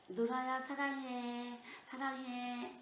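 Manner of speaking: slow, drawn-out singsong; lingering, held-note affection; imitated loving send-off. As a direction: stretch each phrase out tenderly, almost sung. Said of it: "이렇게 해주고 와요".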